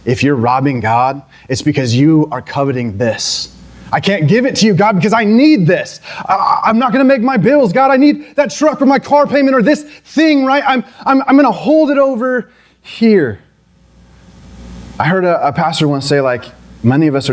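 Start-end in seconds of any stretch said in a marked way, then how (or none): 3.9-13.4 higher, pleading excuses to God; anxious money-clinger. Pretend. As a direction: play someone clutching their money, voice raised and worried. Voicing a person coveting money.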